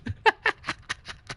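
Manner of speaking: high, breathless, voiceless laugh; breathy wheeze laugh. Real, not acted. Cracking up at own story.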